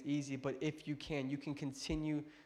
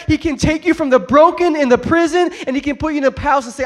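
loud, high, ringing sermon climax; full-force preaching; 9 semitones higher, 16 dB louder than usual. Real, not acted. Preacher urging the hurt to trust God, impassioned full-force preaching. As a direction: preach it out at the top of your voice.

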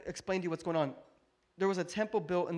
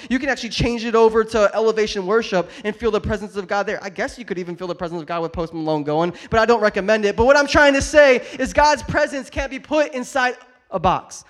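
loud, urgent sermon delivery; full-force preaching; 3 semitones higher, 13 dB louder than usual. Real, not acted. Preacher insists God's presence isn't boxed in, full-force preaching. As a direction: build to an emphatic shout.

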